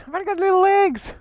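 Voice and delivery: high, far-off mock little voice of Reno; Reno's complaint, lightly mimicked in a high voice; mimicking Reno. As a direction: squeak it like a small girl.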